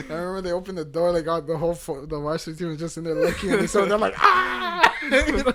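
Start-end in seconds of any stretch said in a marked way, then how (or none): 4.2-4.8 high, loud cackling "ah"; hammed-up laughing outburst; imitated laughter. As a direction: mimic a whole team howling with laughter. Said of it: "just fucking laughing the whole time".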